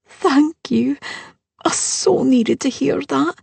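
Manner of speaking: high, heartfelt thanks; grateful bride. Acted. Bride thanking her mother-in-law, high and heartfelt. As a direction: let the relief lift up high.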